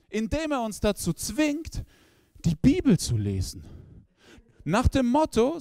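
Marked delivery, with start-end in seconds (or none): none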